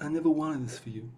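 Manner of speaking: quiet mafia don impression; Godfather. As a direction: do a hushed old-movie mob boss, half amused. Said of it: "Do you have a Godfather impression?".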